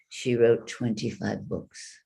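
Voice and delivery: ordinary delivery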